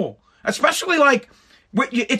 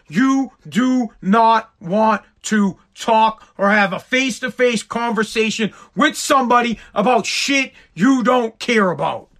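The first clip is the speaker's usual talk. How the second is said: usual speaking voice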